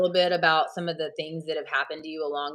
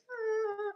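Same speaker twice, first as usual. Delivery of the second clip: tiny high airy "Ah"; a squeaky little secretary gasp; high breathy secretary gasp; 10 dB quieter than usual. Retold or imitated. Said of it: "the secretary who was like".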